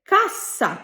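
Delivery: কাসা with a crisp unvoiced s; কাসা, clean hard s, falling tune; sharp s sound. Shown as a demonstration. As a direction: demo কাসা with a clear s. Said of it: "সঠিক উচ্চারণ হবে".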